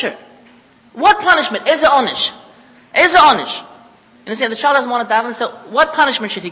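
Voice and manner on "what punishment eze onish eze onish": louder, higher rhetorical demand; indignant challenge. Real, not acted. Rabbi challenging the idea of punishing, loud indignant demand. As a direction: throw the question back hard.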